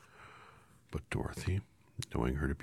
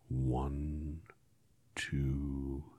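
slow solemn counting; 5 dB quieter, much slower than usual. A narrator's reading. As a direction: count each number gravely. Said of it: "in a solemn voice".

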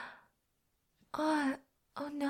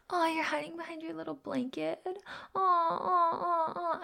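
mock pity; 6 semitones higher than usual. Taunting someone hiding behind a blanket, mock pity. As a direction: pile on the fake sympathy.

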